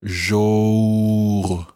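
"Jour" with lips rounded, a long open rounded vowel; slow rounded French "Jour". Shown as a demonstration. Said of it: "and then rounding your lips".

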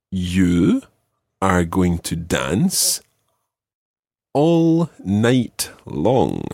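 very slow, deliberate sentence read; slow dictation. Shown as a demonstration. Teacher reading a test sentence to Cara, very slowly. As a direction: spell it out slowly for the learner.

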